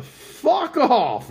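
high, loud burst of contempt; shouted fuck off. Real, not acted. Shouting at Adam Kinzinger on the show, loud and high.

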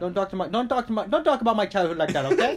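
put-on offended Indian English accent, rising at the end. Defending a childhood to a friend, mock-offended Indian accent. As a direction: warn them off in an Indian accent. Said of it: "you're also good at doing Indian".

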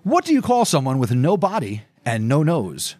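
ordinary delivery

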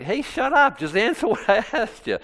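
high, laughing mock outburst; mock exasperation. Traveler to the people mimicking him, mock exasperation. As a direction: snap back in a high, comic protest.

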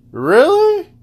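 escalated indignant Really; disbelieving. Real, not acted. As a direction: shriek the word in disbelief. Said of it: "you wanna go there".

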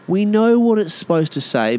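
high-pitched Aussie telling-off; high, mock-indignant reproach; scornful retort. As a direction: shoot down a pretentious mate, pitched high. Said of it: "You're like".

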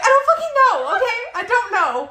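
high, loud, flustered retort; exasperated outburst. Retold or imitated. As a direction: blurt it out, loud and high, fed up. Flustered answer to truck questions.

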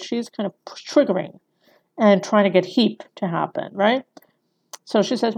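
ordinary delivery

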